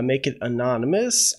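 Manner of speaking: normal talking voice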